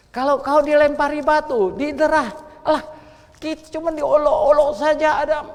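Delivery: loud, high preaching; fiery preaching. Real, not acted. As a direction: preach it out to the congregation. Preaching at full force.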